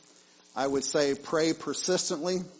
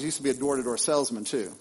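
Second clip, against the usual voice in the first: ordinary talk, nothing marked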